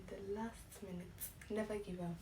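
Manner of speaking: soft, unvoiced urgent whisper. Real, not acted. Vlogger whispers her advice to viewers. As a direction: lean in and whisper it.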